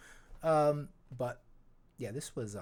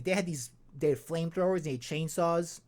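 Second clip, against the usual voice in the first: normal talking voice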